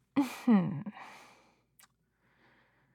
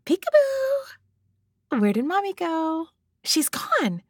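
playful peekaboo; 5 semitones higher, 4 dB louder than usual. Pretend. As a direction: sing it out like to a baby. Playing peekaboo with baby.

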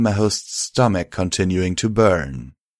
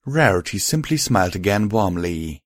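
ordinary delivery, nothing marked

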